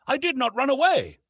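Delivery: ordinary delivery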